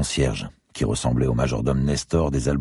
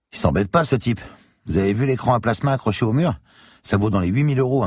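higher, impressed Crombé; Crombé's voice; 10 semitones higher, 3 dB louder than usual. Acted. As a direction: whistle at the pricey gear. Crombé character voice. (Crombé épaté, voix plus aiguë)

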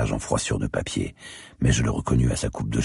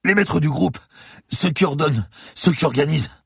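a beaten lawyer gasping in fear; frightened bleeding man pleading with the cop; terrified captive. Acted. Lawyer answers.

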